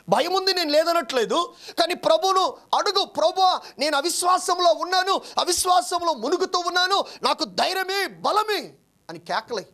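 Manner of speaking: lifted, urgent preaching voice; rising preaching. Acted. Preacher urging his flock to pray, fervent sermon voice. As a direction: press the congregation to cry out to the Lord. Pastor urges doubting listeners to pray.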